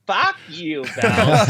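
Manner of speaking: high, strained voice of a cranky teammate, laughing through it; Phil Kessel voice. Retold or imitated. Phil snapping at the strength coach, high put-on voice. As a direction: squeak out the curse in a put-on high voice. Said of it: "make sure you do his voice".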